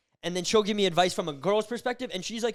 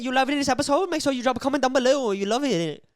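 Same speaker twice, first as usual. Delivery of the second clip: louder, higher YouTuber hype call to viewers; hype host voice; 7 semitones higher, 7 dB louder than usual. Acted. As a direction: pump the audience up like an announcer. Hyping viewers, asking for comments.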